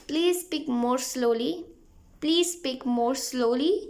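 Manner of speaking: very slow, deliberate English; slow model phrase. Shown as a demonstration. Teacher slowly drilling a polite request for learners. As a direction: stretch the request out word by word. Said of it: "तो आप उनसे यही बोलिए".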